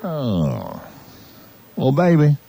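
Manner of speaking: usual speaking voice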